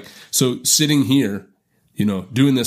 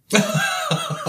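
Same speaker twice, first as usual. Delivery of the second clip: a quick burst of laughter at his own punchline; short laugh. Real, not acted. Telling a friend about the daughter's contract, a short laugh. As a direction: laugh at the joke, brief and high.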